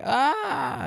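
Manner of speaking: short high yelp of fright; mock startled scream. Heroine startled by her fiance, high mock scream. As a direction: shriek like she's been jump scared. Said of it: "and she goes like".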